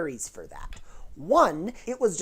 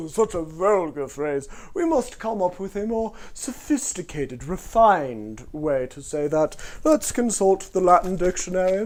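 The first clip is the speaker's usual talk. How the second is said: prissy posh British voice; posh British accent. Retold or imitated. Posh British person scorning a cockney phrase, posh British. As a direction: sniff at the vulgarity in posh British. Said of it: "the posh British person's like".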